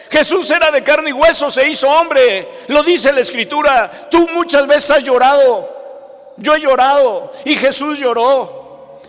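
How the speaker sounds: full-force pulpit delivery; impassioned preaching. Real, not acted. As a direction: preach it out to the congregation, high and forceful.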